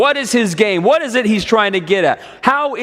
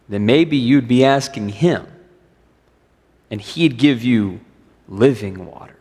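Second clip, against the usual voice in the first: calm voice of Jesus; 7 semitones lower than usual. Acted. Voices Jesus answering the woman.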